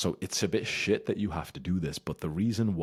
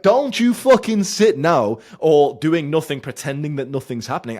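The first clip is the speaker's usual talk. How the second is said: ranting shout; 11 semitones higher, 15 dB louder than usual. Real, not acted. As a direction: bellow the warning at him. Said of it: "ranting at viewer".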